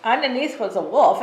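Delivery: ordinary delivery, nothing marked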